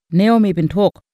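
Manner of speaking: ordinary delivery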